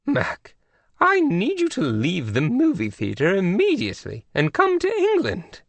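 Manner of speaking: lofty high royal tone; queenly voice. A narrator's reading. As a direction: scold gently with royal poise. Queen of England on phone.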